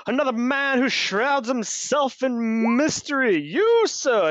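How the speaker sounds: loud, high, grandiose hero voice; booming theatrical adventurer; boastful noble. Acted. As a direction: proclaim it like a swaggering knight. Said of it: "And he goes".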